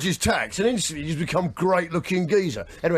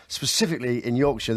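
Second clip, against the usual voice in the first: usual speaking voice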